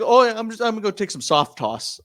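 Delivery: mock casual. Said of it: "where, like".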